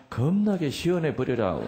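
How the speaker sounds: high, emphatic Jeolla accent; demonstrated Jeolla dialect. Shown as a demonstration. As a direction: lean on "겁나게" like a Jeolla local. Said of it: "전라도 말로는".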